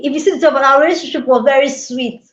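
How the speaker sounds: usual speaking voice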